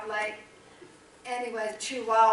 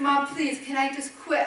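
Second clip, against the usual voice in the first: higher, louder imitated plea; retold pleading; 5 semitones higher, 5 dB louder than usual. Son begging his mom to let him quit, retold pleading. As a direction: beg like an overwhelmed son, lightly acted.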